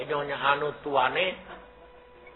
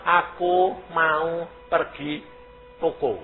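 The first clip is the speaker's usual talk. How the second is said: imitating halting, word-by-word talk; drawn-out impression of a recovering speaker; slow speech; much slower than usual. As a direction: say each word slowly and separately. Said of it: "ngomong kok dadi alon-alon".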